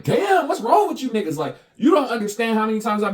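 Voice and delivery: high, loud burst of disbelief; fed-up, high-pitched exclamation; exasperated outburst. Real, not acted. Exasperated outburst at his critics.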